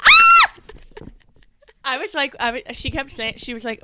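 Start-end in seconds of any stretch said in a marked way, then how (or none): 0.0-0.5 very high, loud squeal; short burst of screaming; excited scream. Acted. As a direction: let out a piercing, high-pitched shriek. Excited squeal while joking around.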